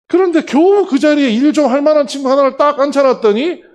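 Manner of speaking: loud, high-pitched venting; exasperated. Retold or imitated. Director fuming over losing a hard-won hire, exasperated. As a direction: let the retold irritation burst out high and loud. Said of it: "짜증이 올라오기 시작합니다".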